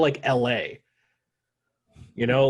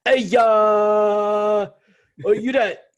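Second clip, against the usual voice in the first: put-on a shouted, botched Boston accent, higher and louder; bad Boston accent; 8 semitones higher, 8 dB louder, much slower than usual. Podcaster shouting like a Boston bar guy, botched Boston accent. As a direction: yell across a bar in a failed Boston accent. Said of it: "I can't even do a Boston accent".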